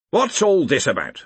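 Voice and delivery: peeved authority figure; irritable. A narrator's reading. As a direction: demand an explanation with impatience. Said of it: "said the Arch-Chancellor irritably".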